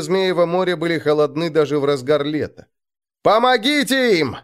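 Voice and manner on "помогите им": high urgent shout for help. A narrator's reading. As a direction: yell it across the shore. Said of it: "закричал Арнгрим".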